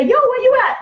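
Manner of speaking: high-pitched joking holler; mock calling out. Grandmothers calling everyone home, as a silly high holler.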